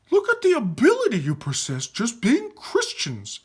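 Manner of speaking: high, excited raving. Acted. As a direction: rave about their powers in awe. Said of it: "Aaron raved".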